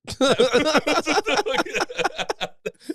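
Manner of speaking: big high burst of laughter; loud high-pitched laughing fit. Real, not acted. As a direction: crack up loudly. Laughing at own punchline.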